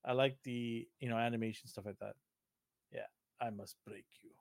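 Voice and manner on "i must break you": low, hushed Russian boxer impression; soft, deep mock-Russian accent; Drago. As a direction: quote it like a menacing Russian fighter. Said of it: "I need that accent".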